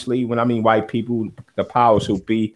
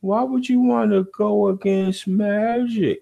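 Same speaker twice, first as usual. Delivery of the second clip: high, put-on voice of a naysayer; mocking the doubters; 9 semitones higher than usual. Host mocking people who question going against Magic, high mock voice. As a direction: mimic the skeptics, pitched up.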